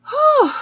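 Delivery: high, loud amazed whew. Real, not acted. Amazed at the long hair.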